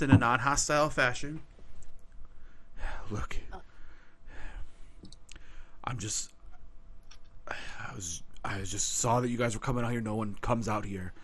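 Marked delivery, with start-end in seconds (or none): 3.1-11.1 low, soft and earnest plea; a hint of a weary forest centaur; lightly voiced centaur. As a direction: ask for help quietly. GM voices centaur asking help.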